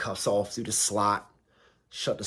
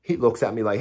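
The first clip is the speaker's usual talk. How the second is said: ordinary talk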